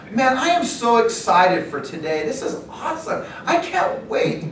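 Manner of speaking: high, laughing fake excitement; mock the joy of rejection calls; sarcastic enthusiasm. Sarcastic cold-calling enthusiasm.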